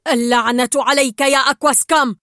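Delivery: loud outburst against the machine; shouted curse. A narrator's reading. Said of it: "ثم صاح جيرجل".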